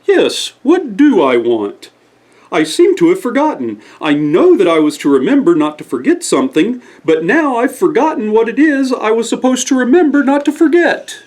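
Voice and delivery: put-on very high, dreamy old owl voice; a forgetful owl thinking aloud; Oscar Owl voice. Oscar Owl's voice.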